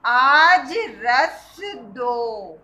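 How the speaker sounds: very slow recitation. Shown as a demonstration. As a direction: read it out slowly for pupils to follow. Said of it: "अब हम इन्हें मिलाकर कैसे पढ़ेंगे?".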